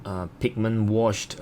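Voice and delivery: ordinary talk, nothing marked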